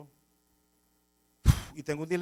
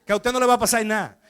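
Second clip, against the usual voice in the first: louder, blunt order; gruff captain; 4 semitones higher, 9 dB louder than usual. Retold or imitated. Captain telling the pastor to kill the thief, louder gruff. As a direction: bark it like a fed-up police captain.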